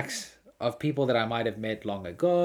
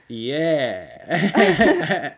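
laughing yeah; 4 semitones higher, 9 dB louder than usual. Retold or imitated. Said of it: "And I go".

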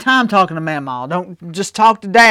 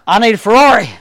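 mock wishful demand; 5 semitones higher, 7 dB louder, faster than usual. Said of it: "I could just say".

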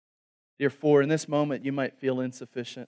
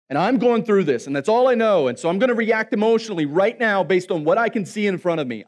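louder, higher, exasperated Elijah; Elijah complaining; 7 semitones higher, 9 dB louder than usual. Retold or imitated. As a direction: play Elijah overwhelmed and self-pitying. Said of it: "And Elijah's like".

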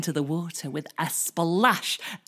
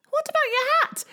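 high nervous little voice; gingerbread man voice; 5 dB louder, much faster than usual. Acted. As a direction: squeak it out, high and unsure.

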